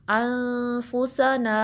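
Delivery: very slow drawn-out chant; slow tajweed recitation; Quranic recitation. Shown as a demonstration. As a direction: recite it slowly, stretching the vowels. Recites word slowly.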